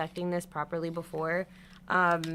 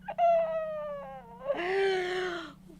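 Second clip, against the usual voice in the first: despairing laugh-cry. Real, not acted.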